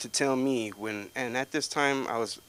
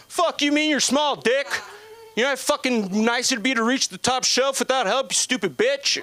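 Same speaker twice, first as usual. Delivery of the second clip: high, loud mocking buddy voice; mocking buddies; 11 dB louder than usual. Buddies jeering at him about being small, high and loud. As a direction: squeal it like a jeering friend.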